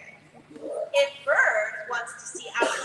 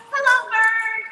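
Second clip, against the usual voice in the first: cheerful greeting; 8 semitones higher, 7 dB louder than usual. Acted. Greeting the book's bird.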